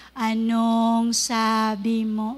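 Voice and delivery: a light hint of a tough guy daring you; Kuya Cesar. Lightly imitated tough man confronting someone, low and slow. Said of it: "Kuya Cesar na".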